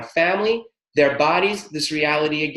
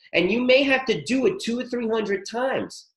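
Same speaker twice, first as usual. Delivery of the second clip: ordinary talk, nothing marked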